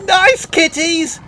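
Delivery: high, loud, slow panicked squeal; terrified boy pleading with tigers. Acted. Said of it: "squealed Bradley".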